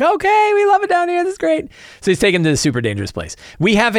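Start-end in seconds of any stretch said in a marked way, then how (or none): 0.0-1.7 very high-pitched excited little goblins; goblin kids. Acted. Goblin scouts to their leader, high and excited. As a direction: squeal it like eager goblin children. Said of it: "the goblins are like".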